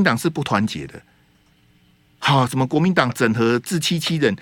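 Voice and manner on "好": normal talking voice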